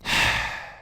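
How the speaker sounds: long breathy exhale, no voice; heavy sigh. A narrator's reading. Twilight at the castle window, a heavy weary sigh. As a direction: let all the air out wearily. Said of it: "After breathing a heavy sigh".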